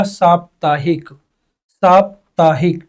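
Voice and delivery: साप्ताहिक said slowly, syllable by syllable; slow spelling demo. Shown as a demonstration. Teacher slowly repeats साप्ताहिक for students to write. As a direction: draw out साप्ताहिक slowly for dictation. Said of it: "यहाँ आपका क्या हो जाएगा".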